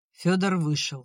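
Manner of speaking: ordinary delivery, nothing marked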